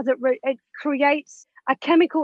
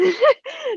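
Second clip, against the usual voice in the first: short high laugh; 11 semitones higher than usual. Real, not acted. Wife joking about no more sex for husband, short laugh. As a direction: laugh at your own grim remark. Laughs at her own grim remark.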